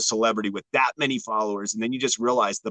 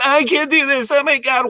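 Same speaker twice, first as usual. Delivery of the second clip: mock high, loud celebrity meltdown; melodramatic breakdown; 12 semitones higher, 5 dB louder than usual. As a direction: imitate a star falling apart, voice pitched way up. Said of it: "how he broke down".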